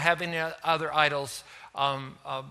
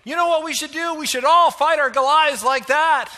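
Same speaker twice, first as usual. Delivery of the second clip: loud, much higher gushing mock excitement; mock enthusiasm; 8 semitones higher, 7 dB louder than usual. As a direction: mimic a naive listener's eager shout. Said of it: "And we say".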